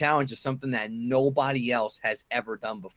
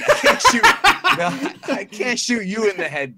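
laughing; 6 semitones higher, 8 dB louder than usual. Real, not acted. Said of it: "I was gonna say Kanji".

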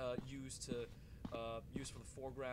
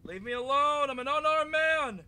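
loud, much higher frightened cries; panicked pleading; 12 semitones higher, 18 dB louder, slower than usual. Real, not acted. Gamer pleading with the chasing monster, panicked. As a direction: beg the monster to back off.

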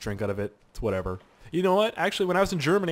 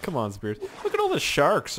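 ordinary talk, nothing marked

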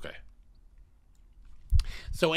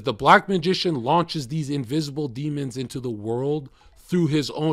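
dramatic reading; 4 semitones higher, 7 dB louder than usual. Acted. Reads passage aloud dramatically.

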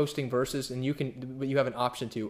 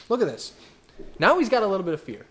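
ordinary talk, nothing marked

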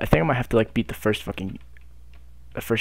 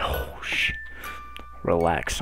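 ordinary talk, nothing marked

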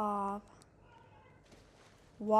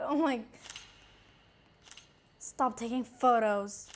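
usual speaking voice

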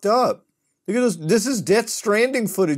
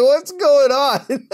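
panicked civilian; 9 semitones higher, 6 dB louder, much slower than usual. Retold or imitated. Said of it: "The civilian's just like".

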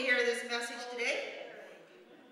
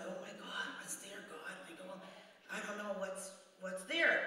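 scared whisper; 5 semitones lower, 8 dB quieter than usual. Retold or imitated. Acting scared night voice.

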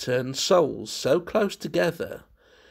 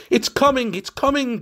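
higher, louder eager cry; excited cry; 11 semitones higher, 6 dB louder than usual. A narrator's reading. Curtis tugging at the shoe, crying out excitedly. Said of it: "he cried".

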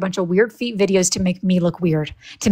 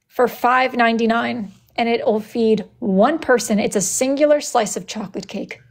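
ordinary delivery

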